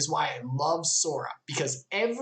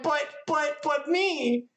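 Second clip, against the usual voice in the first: high, stammered whiny objection; mock protest; 8 semitones higher, slower than usual. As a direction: plead like a spurned kid, pitched up. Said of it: "And Riku's like".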